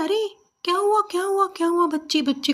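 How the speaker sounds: high, fast, anxious questions; worried mother-in-law. Acted. Mother-in-law rushing to her crying daughter-in-law, high and fast with worry.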